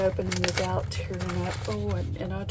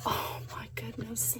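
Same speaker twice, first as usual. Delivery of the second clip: breathless hushed wonder; real awed whisper; whispered delight; 12 dB quieter than usual. Real, not acted. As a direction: whisper it as if it is too cute for words.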